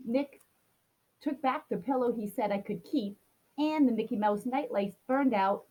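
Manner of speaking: usual speaking voice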